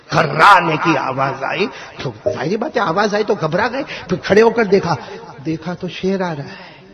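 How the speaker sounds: usual speaking voice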